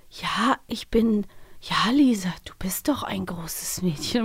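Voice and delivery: whispered imitation of overly calm radio play voices, slow; breathy mock-soothing whisper, making fun of the hush. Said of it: "alle sind so gehalten und so ganz ruhig".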